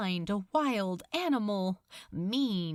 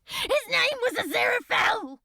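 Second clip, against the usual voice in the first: high, loud, fast shout; terrified scream; 5 dB louder, faster than usual. Acted. Crowley crying out the name to Beelzebub, screaming. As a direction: blurt it out in panic. Terrified confession under torture.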